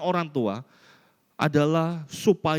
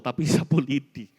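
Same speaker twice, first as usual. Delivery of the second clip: ordinary talk, nothing marked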